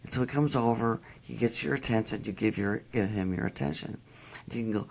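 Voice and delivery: normal talking voice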